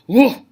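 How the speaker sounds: forceful burst on "whoa"; emphatic. Shown as a demonstration. Teacher showing exclamation marks, emphatic "whoa". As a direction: put some punch behind "whoa". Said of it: "to put emphasis".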